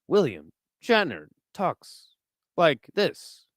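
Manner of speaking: halting delivery, pauses between words; slow, dramatic stop-start cadence; William Shatner. Shown as a demonstration. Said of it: "you kinda have to do, uh, like, w-, uh, Shatner".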